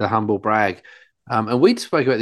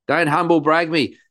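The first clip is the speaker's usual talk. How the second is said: scolding father; 6 semitones higher than usual. Retold or imitated. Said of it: "I go".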